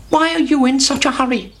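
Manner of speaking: high chirpy hen. A narrator's reading. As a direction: ask like a curious little hen. Henny Penny asks.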